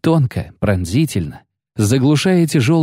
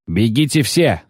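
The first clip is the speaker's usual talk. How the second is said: ordinary delivery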